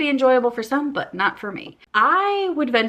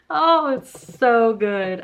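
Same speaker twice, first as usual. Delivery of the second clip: delighted laugh; 2 semitones higher, 4 dB louder, much slower than usual. Real, not acted. Delighted by review phrase.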